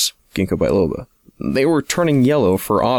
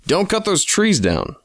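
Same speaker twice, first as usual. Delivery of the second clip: a mild narrated shout, pitched up; mock yell; 9 semitones higher than usual. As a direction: call out to stop the chainsaw crew. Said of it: "I yelled".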